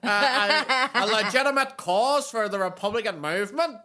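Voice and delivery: put-on high, loud, laughing Belfast accent. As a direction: mimic a defiant Belfast ex-gunrunner. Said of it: "Even that's a better accent".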